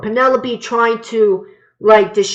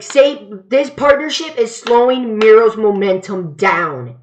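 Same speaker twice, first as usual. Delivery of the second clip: ordinary delivery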